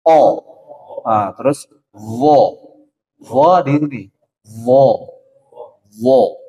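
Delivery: "ho, so, bo" full and heavy, slow; thick letter drill. Shown as a demonstration. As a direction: round but not pursed: "bo" not "bu". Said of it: "semuanya konsonannya sama". ("bo" tebal, jangan monyong)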